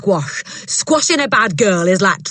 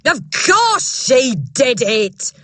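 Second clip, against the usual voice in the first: very slow, loud, higher boom; 7 semitones higher, 6 dB louder, much slower than usual. Acted. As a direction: hammer each word. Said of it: "Miss Trunchbull boomed".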